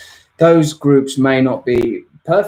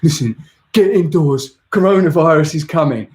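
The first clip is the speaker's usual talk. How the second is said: higher, louder put-on warning voice; doom-laden friend; 6 semitones higher, 6 dB louder than usual. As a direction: warn of doom like a prophet.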